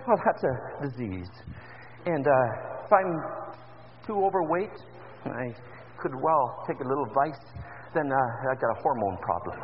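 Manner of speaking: ordinary delivery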